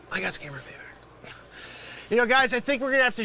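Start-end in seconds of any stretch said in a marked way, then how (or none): 0.1-1.3 hushed, unvoiced whisper of defeat; stunned whisper of defeat after getting scammed. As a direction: breathe it out in disbelief.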